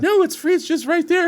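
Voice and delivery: high, lightly voiced helpful lady; lady's answer. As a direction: point him on, kindly.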